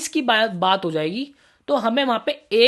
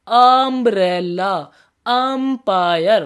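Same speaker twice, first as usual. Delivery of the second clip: very slow, louder: umbrella, empire stretched out with the starting vowel sound held; slow demo; 4 dB louder, much slower than usual. Shown as a demonstration. Teacher demonstrates the vowel sound to students, very slow. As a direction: drag out each word so students hear its first sound. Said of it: "sound को preference दो।".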